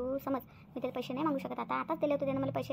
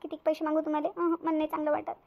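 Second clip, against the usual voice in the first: normal talking voice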